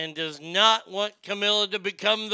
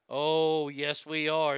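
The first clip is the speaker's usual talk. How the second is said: ordinary talk, nothing marked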